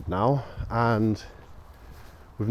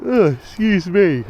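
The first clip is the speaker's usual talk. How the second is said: voicing the dog; 9 semitones higher, 7 dB louder, slower than usual. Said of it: "He said".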